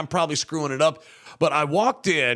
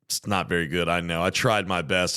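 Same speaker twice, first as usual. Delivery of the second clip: usual speaking voice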